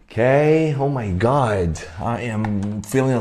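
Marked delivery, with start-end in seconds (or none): none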